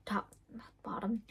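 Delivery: whispered self-correction; quick hushed correction. Real, not acted. Correcting herself to viewers, a hushed whisper.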